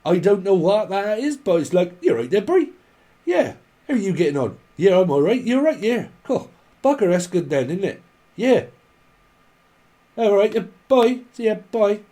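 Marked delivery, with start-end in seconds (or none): 2.0-2.7 high, loud mock Cornish greeting; hammed-up Cornish yokel hello; imitating a Cornish local; Cornish accent. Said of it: "got right proper accent". 3.2-12.0 chatty mock Cornish small talk, pitched high; hammed-up Cornish locals chatting; imitating a Cornish chat; Cornish accent. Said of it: "got right proper accent".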